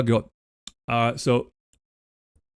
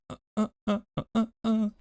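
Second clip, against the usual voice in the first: rhythmic grunts; 9 semitones higher, 6 dB quieter, much faster than usual. Pretend. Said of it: "playing along to music".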